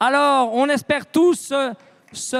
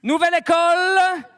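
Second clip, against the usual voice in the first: hyped announcement; 4 semitones higher than usual. Real, not acted. Revealing best tattoo of show.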